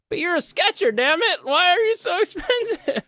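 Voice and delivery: very high, squealing complaint; comic indignation, voice shooting way up; mock outrage. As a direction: protest in a hammed-up squawk. Acted outraged buyer over prices.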